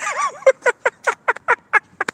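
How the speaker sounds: high cackling laugh; gloating laugh. Real, not acted. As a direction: cackle in triumph. Gloating over viewers.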